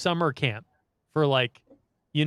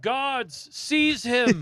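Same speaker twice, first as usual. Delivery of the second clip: mock royal command; 9 semitones higher, much slower than usual. Quoting the vampire ordering guards.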